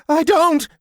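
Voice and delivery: high, loud shrill squeal; small creature shrilling in protest; shrill cry. A narrator's reading. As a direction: shriek it out in a panic. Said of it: "he shrilled".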